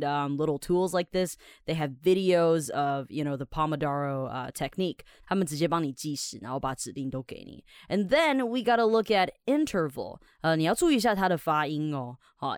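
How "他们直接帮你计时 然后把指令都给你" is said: ordinary talk, nothing marked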